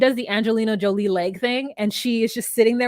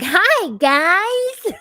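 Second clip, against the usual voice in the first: high, louder put-on Gino hello; Gino's greeting; 9 semitones higher, 6 dB louder than usual. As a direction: chirp a cheesy hello. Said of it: "he's like".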